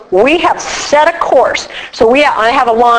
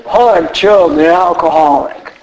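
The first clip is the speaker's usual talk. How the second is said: lower, gruff imitation of an old-timer's intro; playful lowered mock of a man introducing himself; gruff alcoholic at a meeting; 7 semitones lower than usual. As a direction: growl it out like a grizzled regular at a meeting. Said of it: "They talk like that".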